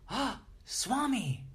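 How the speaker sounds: imitated gasp, then a high, drawn-out cry; super excited. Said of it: "they get super excited".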